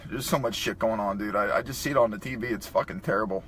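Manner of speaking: usual speaking voice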